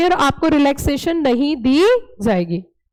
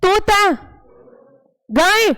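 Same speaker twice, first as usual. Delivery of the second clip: quick game calls; 3 semitones higher, slower than usual. Acted. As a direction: call each bird out sharply to the kids. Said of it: "अब मैं जल्दी जल्दी बोलूंगी". (बच्चों के खेल में ऊँची, ज़ोरदार पुकार)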